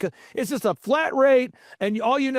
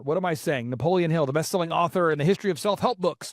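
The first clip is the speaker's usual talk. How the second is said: ordinary talk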